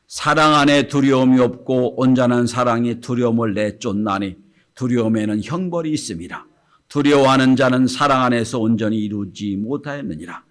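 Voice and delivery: loud, measured Bible reading; scripture reading. Acted. Pastor reads the verse aloud to the congregation, measured and loud.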